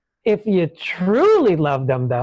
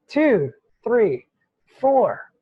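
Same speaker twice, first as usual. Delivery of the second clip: ordinary delivery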